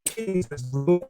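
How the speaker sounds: ordinary delivery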